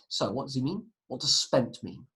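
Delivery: normal talking voice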